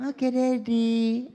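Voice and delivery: put-on high, slow little girl voice; mock obedient child; sweet child. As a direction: agree sweetly. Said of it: "child agreeing".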